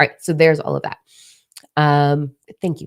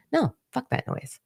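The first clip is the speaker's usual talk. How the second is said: sharp high-pitched brush-off; dismissive; 11 semitones higher, 5 dB quieter than usual. Real, not acted. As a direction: toss it away with disdain. Firmly rejecting her old habits.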